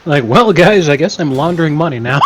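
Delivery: shouted through laughter; cracking up while yelling; laughing shout. Acted. Mock announcement while laughing.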